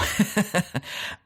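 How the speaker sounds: breathy high-pitched laugh; quick airy laugh of disbelief. Real, not acted. Reacting to Mickey's twist.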